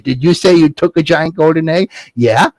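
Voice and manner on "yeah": ordinary delivery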